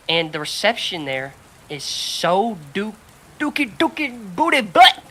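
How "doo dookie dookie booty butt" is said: silly gibberish. Pretend. Mocking bad phone reception.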